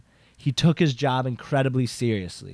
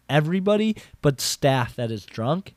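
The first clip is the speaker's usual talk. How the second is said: ordinary delivery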